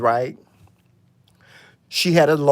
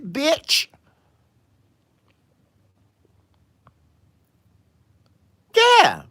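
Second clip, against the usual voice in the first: high outraged rant; 9 semitones higher than usual. Real, not acted.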